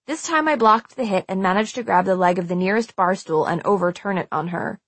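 ordinary delivery

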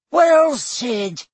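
high squeaked praise; squeaky. A narrator's reading. Flitwick to the staff, squeaking approval. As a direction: squeak it out eagerly. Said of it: "squeaked Professor Flitwick".

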